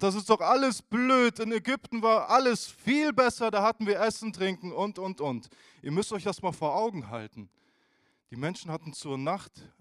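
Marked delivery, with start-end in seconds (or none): 0.0-5.5 loud, higher, whining complaint; the Israelites grumbling in the desert, loud and whiny; grumbling crowd. Retold or imitated. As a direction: moan that everything was better before. Grumbling people quoted.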